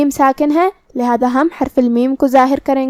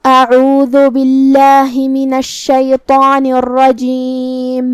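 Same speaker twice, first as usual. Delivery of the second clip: slow, melodic tilawat; Quran recitation; much slower than usual. Acted. Teacher reciting the verses for students, melodic and slow. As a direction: chant the verses slowly with long vowels. Full surah recitation announced.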